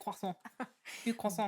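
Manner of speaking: French accent. Shown as a demonstration. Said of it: "the more French accent I put on it".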